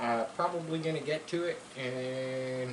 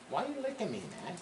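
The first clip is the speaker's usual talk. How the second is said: playful dog talk; 4 semitones higher, 6 dB quieter, much faster than usual. Real, not acted. Dog licking him.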